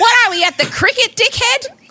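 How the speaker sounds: high-pitched exasperated comeback; mock outraged retort. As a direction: heckle back in comic disbelief. Mock retort to his yelling.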